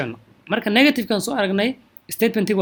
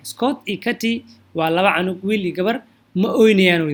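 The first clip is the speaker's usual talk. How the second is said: ordinary talk, nothing marked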